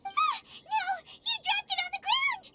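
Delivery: high, alarmed exclamation; dismayed cry. Acted. Companion sees the food fall, dismayed high cry.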